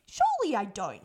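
a loud, indignant denial; loud protest. Retold or imitated. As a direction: push back hard, you can't believe it. Protesting the sister's verdict.

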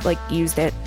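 ordinary talk

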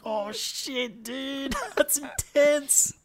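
high voice breaking into laugh; character voice. Retold or imitated. Reacting in character.

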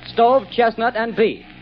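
ordinary delivery

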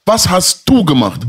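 heated demand. Real, not acted. Heated argument, demanding answers.

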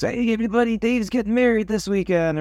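high, excited call to the room; the barber Jimmy announcing to the whole shop. Retold or imitated. Barber calls out the news to everyone, high and excited. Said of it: "And Jimmy goes".

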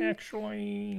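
high, soft, slowly stretched "actually"; toddler's filler. Retold or imitated. Parent echoes a toddler's filler, high drawn-out imitation. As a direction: draw the word out small and high.

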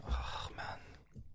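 breathy whispered groan; mimicking his own fed-up whisper; whispered dismay. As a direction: whisper it in defeat. Exasperated reaction.

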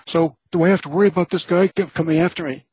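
high, squeaky Mickey Mouse fright; high-pitched nervous squeak; squeaky scared voice. Retold or imitated. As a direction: let the voice jump up in panic. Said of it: "my voice goes up a couple of octaves, and I sound like Mickey Mouse".

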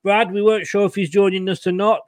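ordinary delivery

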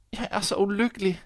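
high girlish voice with a hint of sobbing; a touch of tearful girl; mock sobbing girl. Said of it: "hulkede pigen".

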